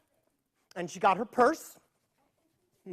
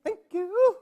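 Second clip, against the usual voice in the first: grandma; 11 semitones higher than usual. Acted. Grandma thanks storekeeper.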